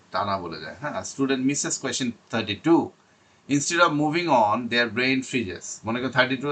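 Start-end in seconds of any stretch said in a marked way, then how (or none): 1.0-5.6 slow, louder heading reading. Shown as a demonstration. Teacher reading the section heading to students, slow. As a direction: announce the section heading very slowly. Reads English notes slowly.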